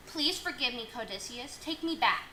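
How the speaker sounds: soft pleading. Acted. Pleading softly.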